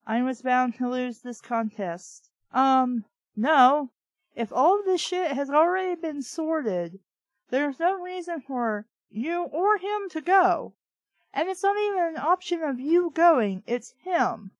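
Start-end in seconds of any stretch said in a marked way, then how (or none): none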